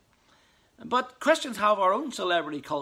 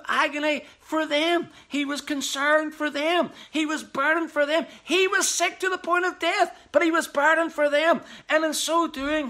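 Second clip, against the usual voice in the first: impassioned preaching; 7 semitones higher, 3 dB louder than usual. Acted.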